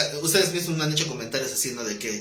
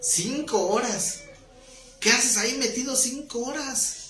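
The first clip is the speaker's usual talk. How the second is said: higher, mocking disbelief; incredulous friend; 8 semitones higher than usual. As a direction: scoff at the idea of church. Amigo incrédulo ante la iglesia. (amigo incrédulo y burlón)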